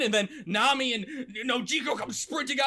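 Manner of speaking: ordinary talk, nothing marked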